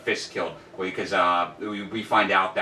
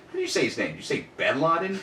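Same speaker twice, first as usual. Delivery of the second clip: puzzled, higher questioning voice; Larry David; 8 semitones higher than usual. Retold or imitated. Retelling a Curb scene as Larry questions Richard, mock puzzled. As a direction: lilt up sharply in mock disbelief. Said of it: "Larry goes".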